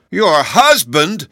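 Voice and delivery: loud, high, drawn-out disbelief; shocked, high-pitched question; exclaimed dismay. A narrator's reading. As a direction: cry out slowly in dismay. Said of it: "exclaimed in dismay".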